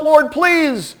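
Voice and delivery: high, loud urgent plea; pleading prayer. Retold or imitated. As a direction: beg the Lord for help. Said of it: "I need the Lord's help".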